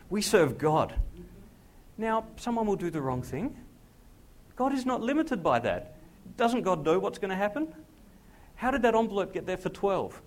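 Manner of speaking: high, loud preaching; fiery preaching. Real, not acted. Pastor stirring the congregation, preaching loud. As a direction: preach it at full force. Preaching rises to full force.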